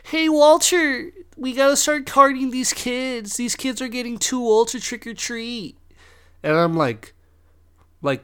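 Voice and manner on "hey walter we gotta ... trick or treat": mocking neighbor voice. Mocking homeowner talking to Walter about carding kids, high whiny imitation. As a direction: mimic a prissy neighbor refusing candy. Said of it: "they're like, they say stupid shit".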